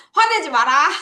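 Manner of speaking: teacher jokingly scolding the class; playful scold. Pretend. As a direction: tease them with a fake scold. Teasing students after laughing.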